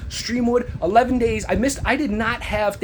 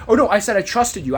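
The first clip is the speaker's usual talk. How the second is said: normal talking voice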